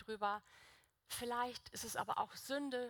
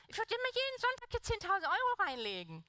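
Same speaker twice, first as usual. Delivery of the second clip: mock eager; 11 semitones higher, 4 dB louder than usual. Imagining endless money, playful boast.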